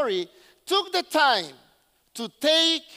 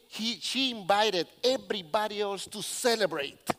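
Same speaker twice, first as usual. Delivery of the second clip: normal talking voice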